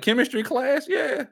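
mock coed answering a pickup line; high girly voice. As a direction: squeak it like a young college girl.

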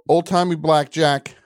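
normal talking voice

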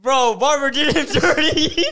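high, loud laughing. Real, not acted. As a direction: laugh through the words. Laughing at haircut.

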